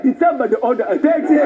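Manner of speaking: put-on low, quick Nigerian accent; mock Nigerian accent. Nigerian taxi driver asks for his fare, low Nigerian accent. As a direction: call out in an imitated Nigerian accent. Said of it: "a Nigerian taxi driver".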